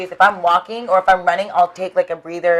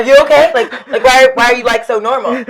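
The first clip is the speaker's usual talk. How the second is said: high, loud disbelieving squeal; incredulous, laughing at the calm girl; mock panic at a calm hostess; retold panic; 6 semitones higher, 6 dB louder than usual. Retelling her panic in earthquake.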